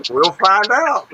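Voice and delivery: real laughing. Real, not acted. Teasing a friend about the trivia questions, loud laughing. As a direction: crack up and belt it out. Laughing at co-host's self-deprecating joke.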